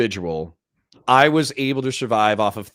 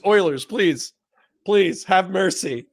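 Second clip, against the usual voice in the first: higher, drawn-out playful begging; mock pleading; 5 semitones higher, slower than usual. Host begging the Oilers for mercy, mock pleading. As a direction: beg for mercy, half in jest. Jokingly begs listeners for mercy.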